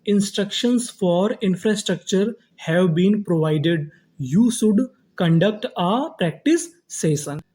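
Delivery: very slow, separated dictation-style reading; slow word-by-word reading. Shown as a demonstration. Teacher reading the built sentence to students, slow and word by word. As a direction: read the sentence slowly, pausing between words. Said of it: "तो पूरा sentence क्या बना".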